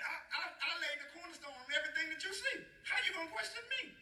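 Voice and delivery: high-pitched, incredulous God voice; God's indignant boast. Acted. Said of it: "God can talk like that".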